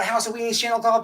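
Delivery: normal talking voice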